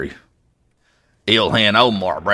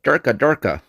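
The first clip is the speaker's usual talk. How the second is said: mock gibberish; 5 semitones higher than usual. Mock gibberish reply to an oath.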